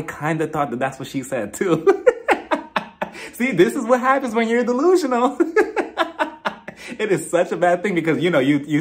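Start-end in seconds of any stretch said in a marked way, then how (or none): 1.9-3.3 a high burst of real laughter. Real, not acted. Admitting she misheard too, real high laugh. 5.4-6.9 real laugh. Real, not acted. Laughs at being delusional.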